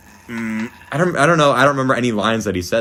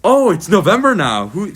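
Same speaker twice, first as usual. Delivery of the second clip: imitating teacher; 6 semitones higher, 3 dB louder than usual. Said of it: "And she goes".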